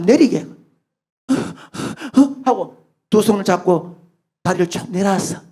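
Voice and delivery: ordinary talk, nothing marked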